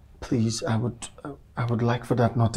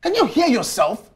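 incredulous, high, loud outburst; shouting; 9 semitones higher, 6 dB louder than usual. Acted. Man challenging her year of indecision, angry shouting. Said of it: "You're shouting".

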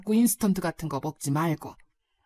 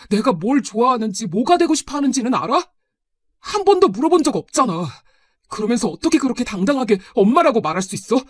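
angry reproach; 3 semitones higher, 5 dB louder than usual. Acted. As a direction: confront her with mounting hurt and anger. Son confronts mother in quarrel. (화가 나서 따지는 말투)

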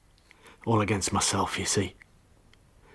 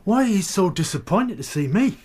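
higher, rising suspicion, pushing the question at her; angry accusation; 9 semitones higher than usual. Acted. Jealous husband confronting his wife, angry and high. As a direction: let jealousy crack the pitch upward.

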